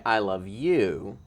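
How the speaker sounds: "you" drawn out and lifted at the end of "I love you"; stress on you. Shown as a demonstration. Cohost showing it means seeing the other person, stress on "you". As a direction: swell onto "you" in a slow "I love you". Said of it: "that means you see the other person".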